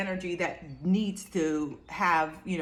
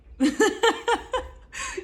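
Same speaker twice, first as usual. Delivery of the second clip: high laugh; 9 dB louder than usual. Real, not acted. Laughs at own advice.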